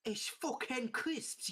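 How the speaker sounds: slow, drawn-out "fucking crisps" in a British accent. Shown as a demonstration. British accent, correcting a friend on how to say crisps. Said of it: "You need to say it right".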